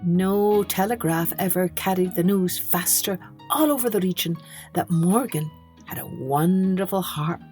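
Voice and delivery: ordinary delivery, nothing marked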